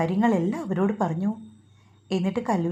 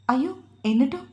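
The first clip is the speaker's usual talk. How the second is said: mildly alarmed, higher-pitched question; slightly raised voice; 5 semitones higher, faster than usual. A narrator's reading. Mother asks son about the fainting, slightly raised voice. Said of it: "അല്പം ഉറക്കെ ചോദിച്ചുകൊണ്ട്".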